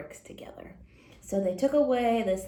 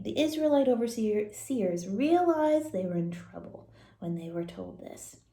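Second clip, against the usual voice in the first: usual speaking voice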